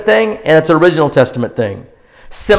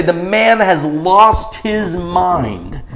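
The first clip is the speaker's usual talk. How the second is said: mocking townsfolk; 3 dB quieter, much slower than usual. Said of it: "they would say".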